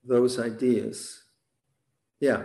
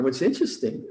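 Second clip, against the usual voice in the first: normal talking voice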